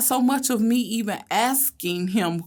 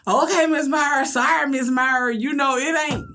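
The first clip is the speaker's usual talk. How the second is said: put-on kids apologizing; 7 semitones higher, 4 dB louder than usual. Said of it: "And they be like".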